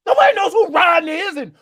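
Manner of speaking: loud, high exasperated rant; exasperated complaint. Real, not acted. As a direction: shout it in exasperation at your co-host. Exasperated complaint about show updates.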